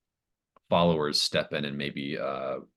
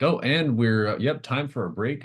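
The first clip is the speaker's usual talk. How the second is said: higher, louder, hurried cut to break; flustered anchor; 8 semitones higher, 5 dB louder than usual. Acted. Game master voicing a TV anchor cutting away, flustered. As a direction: anchor dodging the topic, hammed up. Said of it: "And they're like".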